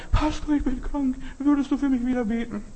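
high-pitched put-on woman's voice; high mock plea to the pastor; imitated pleading woman. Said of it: "eine Frau, für ein ganzes Jahr".

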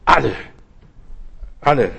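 "Alle" repeated short and falling, each a stand-alone hammer blow; "Alle" said twice, clipped and final; emphatic alle. Real, not acted. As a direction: drive home "Alle" with a pause between. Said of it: "alle in Christus wieder lebendig".

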